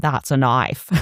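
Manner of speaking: Australian accent, catchphrase delivery; Crocodile Dundee. Shown as a demonstration. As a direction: do a quick Aussie movie-hero impersonation. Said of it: "I can do Crocodile Dundee".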